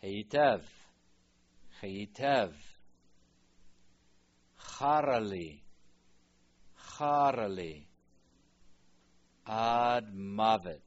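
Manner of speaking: Heitev and Charali called out loudly and slowly; emphatic loud drill. Shown as a demonstration. Teacher drilling Jonah's angry reply: loud, slow calls. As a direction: boom each word out for the class. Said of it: "drills Jonah's loud answer".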